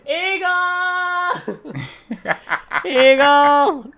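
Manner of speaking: screeched eagle call, very high and drawn out; eagle shout. Pretend. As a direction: cry out the name like a bird of prey. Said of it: "I was just gonna shout".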